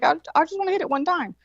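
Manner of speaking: high-pitched wheedling request; insistent pleading. Retold or imitated. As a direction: plead for just one little try. Retold pleading for one try.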